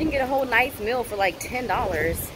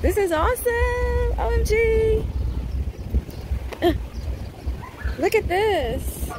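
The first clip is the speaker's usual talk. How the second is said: excited; 13 semitones higher, 3 dB louder than usual. Real, not acted. Thrilled exploring an old ship.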